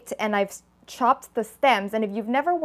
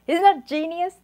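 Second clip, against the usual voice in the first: playful boast; 8 semitones higher, 3 dB louder than usual. Real, not acted. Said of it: "That's totally genius".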